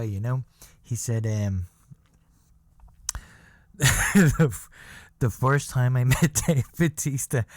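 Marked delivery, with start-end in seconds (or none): 3.8-7.4 laughing loudly through the words; can't get it out for laughing. Real, not acted. Retelling the airport story, laughing out loud.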